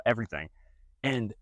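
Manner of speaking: ordinary talk, nothing marked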